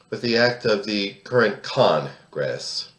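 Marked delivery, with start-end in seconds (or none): 1.6-2.9 Congress with the first syllable CON leaned on, slowed down; slow down and punch CON in Congress for the pun; stress on CON. Real, not acted. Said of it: "emphasis on the first syllable".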